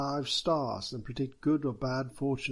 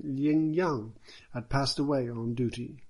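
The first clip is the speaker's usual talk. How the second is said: ordinary delivery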